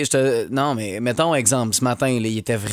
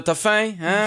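put-on high baby talk to a pet; talking to a cat; 8 semitones higher, slower than usual. Shown as a demonstration. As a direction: coo at the cat. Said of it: "Fais-moi une démonstration un peu".

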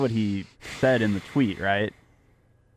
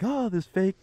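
higher-pitched mock presenter voice; 9 semitones higher than usual. Imitating O J in his Hertz commercials, presenter voice. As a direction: put on a fake commercial presenter voice. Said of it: "this is his presenter voice".

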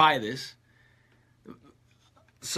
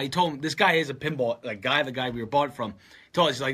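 normal talking voice

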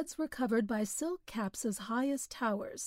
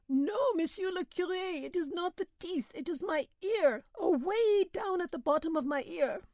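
complaining peasant; 8 semitones higher than usual. A narrator's reading. Suffering peasant Belhomme voiced.

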